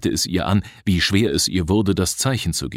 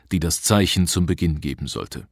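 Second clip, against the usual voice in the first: plain reading voice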